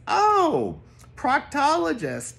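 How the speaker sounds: high excited oh and loud guess; a delighted high know-it-all reply; eager exclaim. Retold or imitated.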